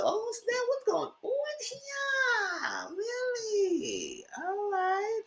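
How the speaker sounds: high, soft drawn-out squeal; retold shocked reaction. Viewer reacting to what's going on in the show, mock shock. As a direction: squeal your disbelief in a small high voice.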